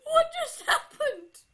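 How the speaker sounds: very high, laughing and tearful; laughing cry. Real, not acted. Player laughing at the jump scare, half-crying laugh. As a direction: laugh it out in disbelief.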